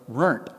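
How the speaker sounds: "runt" said like "burnt" with an r-colored vowel; Appalachian "runt", rhymes with burnt. Shown as a demonstration. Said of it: "runt" rhymes with "burnt".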